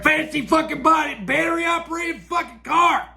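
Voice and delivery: sudden high, angry yell on the last words; angry shout. Real, not acted. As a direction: blow up at the end of the rant. Ranting about China and electric cars.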